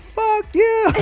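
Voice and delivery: high laughing fuck you; tossed off through laughter; mock outrage while laughing; laughing retort. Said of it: "I was like".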